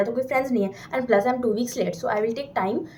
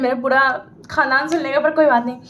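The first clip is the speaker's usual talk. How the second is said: normal talking voice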